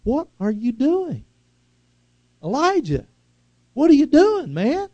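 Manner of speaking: high, loud, searching challenge; God questioning. Retold or imitated. God calling into the cave to Elijah, loud and high. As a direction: demand an answer from the hiding prophet. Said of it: "And so God asks him".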